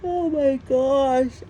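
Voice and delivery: slow, high, loud oh my gosh; drawn-out startled gasp of words; surprised exclamation. Real, not acted. Exclaims suddenly.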